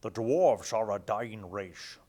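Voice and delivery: slow, hammed-up Scottish brogue on the dwarf lore; horrible Scottish accent. Reading dwarf lore to viewers in a bad Scottish accent. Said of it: "This is my horrible Scottish accent".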